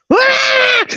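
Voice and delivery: short high-pitched mock scream; sudden acted scream, far above speaking pitch; imitated scream. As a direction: shriek like mom in the story. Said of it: "my mom starts screaming".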